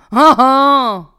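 very loud, forced "ah ah"; hammed-up, drawn-out fake laugh; mock laugh. A narrator's reading. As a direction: throw out a sarcastic laugh. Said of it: "fit-il".

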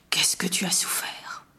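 retold in a soft near-whisper; hushed compassion. Friend reacting to her love poems, hushed retelling.

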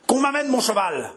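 high, harsh, barely controlled outburst; hoarse voice. Acted. François cutting off the officer, hoarse high order. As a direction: bark the order, voice cracking raw. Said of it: "d'une voix rauque et presque inintelligible".

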